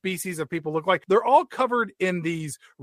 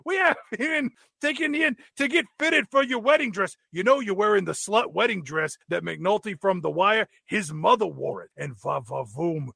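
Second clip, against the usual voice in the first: princess's hammy dad; 2 semitones higher than usual. Acted. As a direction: gush like an overemotional dad at a wedding. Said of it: "Turns out it's the princess's dad".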